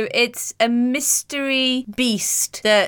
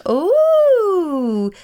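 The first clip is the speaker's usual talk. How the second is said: excited; 8 semitones higher, 4 dB louder than usual. Real, not acted. Excited at unicorns.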